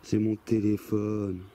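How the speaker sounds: deep slow ghostly voice. Retold or imitated. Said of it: "une grosse voix qui sort de nulle part".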